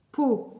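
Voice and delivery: "po" begun on the lips, then released loud; "po" as a loud, isolated model syllable; sound started with the lips. Shown as a demonstration. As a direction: pop the p of "po" from the lips. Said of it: "le début donc, du son avec les lèvres".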